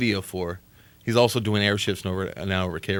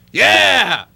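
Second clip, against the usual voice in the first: jæ, yelled much higher and louder; shouted yeah; 13 semitones higher, 9 dB louder than usual. Real, not acted. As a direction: throw the yeah back at the caller hard. Host shouts back at the caller.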